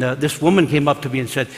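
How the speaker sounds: ordinary talk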